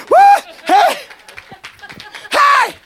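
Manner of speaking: high, loud whoops of praise; jubilant whoop. Real, not acted. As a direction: whoop with joy.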